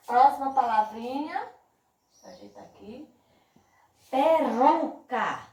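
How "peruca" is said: "Peruca" very slowly, with a strong RU; stressed middle syllable. Shown as a demonstration. Teacher quizzing pupils on syllables, slow "Peruca" leaning on RU. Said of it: "Qual foi a sílaba mais forte?".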